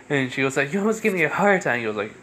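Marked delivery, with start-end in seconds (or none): 0.7-1.8 put-on fast, high-pitched girl; acted fright, rushed and pitched up; startled protest. Girl scolds him for scaring her, rushed high-pitched protest.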